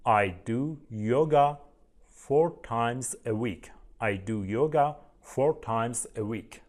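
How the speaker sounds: slow, careful model sentence; very slow model answer; slow teacher demo. Shown as a demonstration. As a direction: read it out slowly for the class. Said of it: "یوگا کار کردن چی بود دوستان؟".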